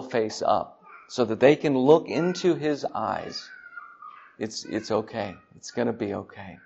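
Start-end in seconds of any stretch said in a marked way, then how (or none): 4.4-6.6 tender reassurance. Acted. Father comforting a child who did wrong.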